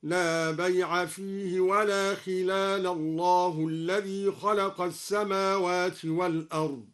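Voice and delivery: connected tajweed recitation of بيع; Quranic recitation. Shown as a demonstration. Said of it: "ولما يصل".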